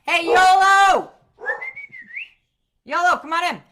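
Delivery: loud greeting call. Real, not acted. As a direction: call out to someone at the door. Calling out to arriving guest.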